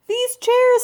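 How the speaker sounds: high, loud, exasperated; exasperated cry. Acted. Mr Hardy wails at the painted chairs, high and loud. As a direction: wail it at the ruined chairs.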